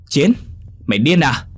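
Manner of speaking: high, loud incredulous yell; shouting in alarm. A narrator's reading. Tùng to his friend leaping off the bridge, shouting. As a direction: shout it in shocked disbelief. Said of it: "Anh Tùng hét lên".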